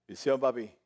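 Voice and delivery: low, restrained greeting; sober grown-up son. Retold or imitated. Older son greeting his father, sober and subdued. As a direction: greet Dad like a composed little man. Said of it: "Ya él está sobrio".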